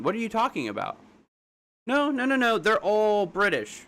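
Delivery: high-pitched, disbelieving protest; incredulous objection. Real, not acted. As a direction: push back in disbelief, pitch jumping up. Disputing a viewer's chat comment.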